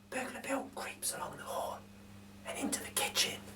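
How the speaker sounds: hushed storytelling whisper. A narrator's reading. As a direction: breathe it like sneaking past sleepers. Sneaking burglar scene.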